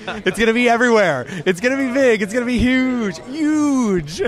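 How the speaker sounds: mock-grand high hype, falling on each end word; hype man voice. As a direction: sell it like a huge announcement. Hyping the meme shirt.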